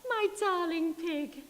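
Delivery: a prim lady answering the phone. Acted. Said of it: "she said".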